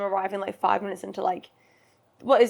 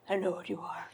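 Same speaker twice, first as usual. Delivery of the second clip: breathy Twilight-style whisper; dramatic whisper; 8 dB quieter than usual. Acted. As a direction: whisper it like a brooding vampire movie line. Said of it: "versions of Twilight in my head".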